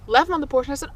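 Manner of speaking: usual speaking voice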